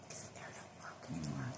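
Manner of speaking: breathy hushed whisper, no voice at all. Real, not acted. Muttering to viewers about the broken heater, whispered. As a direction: drop to a secret whisper.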